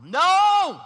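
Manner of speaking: high, explosive yell of protest. Acted. Peter protesting to Jesus, yelling. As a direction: bellow it out in outright refusal. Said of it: "literally starts to just yell".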